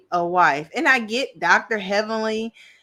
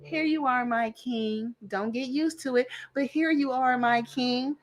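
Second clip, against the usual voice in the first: grudging submissive wife; 3 semitones higher, 5 dB quieter than usual. Retold or imitated. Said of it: "the wives fell in line and was like".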